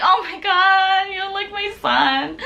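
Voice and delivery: high, drawn-out retold fawning; gushing squeal. Fan greeting the adorable idol, high gushing squeal. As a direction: wail it adoringly, high and slow.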